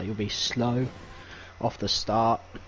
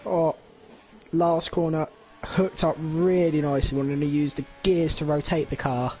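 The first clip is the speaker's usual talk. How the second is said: live commentary; 4 semitones higher than usual. Acted. Live lap play-by-play.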